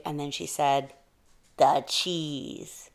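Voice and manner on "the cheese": drawn-out, dramatic falling "cheese"; awed reveal. A narrator's reading. Big-eyed mouse revealing what she wants, awed and drawn out. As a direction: let "cheese" linger in wonder.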